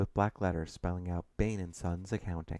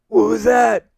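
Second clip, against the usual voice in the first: very high, loud outburst; outraged challenge; 14 dB louder, slower than usual. Acted. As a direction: fire it back indignantly. Client challenges the interjection.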